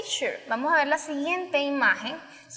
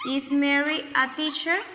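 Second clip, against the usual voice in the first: "Is Mary a teacher?" said slowly with a rising end; slow teacher demo of a question; slow model question; 6 dB louder, slower than usual. Shown as a demonstration. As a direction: ask it slowly so students hear each word. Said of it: "Is Mary y el complemento".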